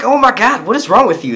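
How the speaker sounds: loud, high-pitched exasperated scolding; retold exasperation. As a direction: tell her off in disbelief. Retelling his reaction to girlfriend.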